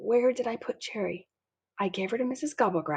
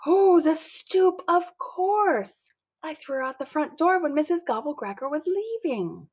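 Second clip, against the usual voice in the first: higher, louder sudden recall; loud realization; 9 semitones higher, 4 dB louder than usual. A narrator's reading. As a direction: it dawns on you all at once. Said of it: "my thoughts are loud".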